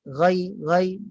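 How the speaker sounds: GHAIRIL with a thin, open 'ai'; low articulation. Shown as a demonstration. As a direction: keep the tongue root down on ghai. (ghai rendah, lidah tidak dinaikkan)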